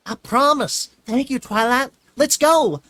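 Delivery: high, loud, cheerful filly. A narrator's reading. Filly thanking Twilight on the way, cheerful. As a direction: chirp it out happily. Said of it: "Apple Bloom said cheerfully".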